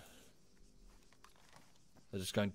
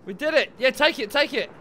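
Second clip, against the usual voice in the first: loud, high victory shout; excited cheer; 8 semitones higher, 6 dB louder than usual. Real, not acted. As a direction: celebrate the win aloud. Squad captures objective.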